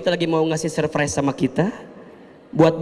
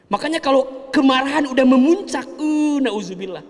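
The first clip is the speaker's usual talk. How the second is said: ordinary delivery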